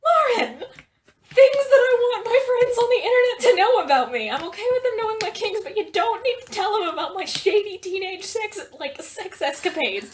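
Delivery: loud high laughing outrage; laughing scold. Real, not acted. Friend exposed on the recording, loud laughing scolding.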